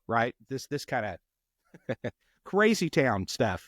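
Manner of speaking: normal talking voice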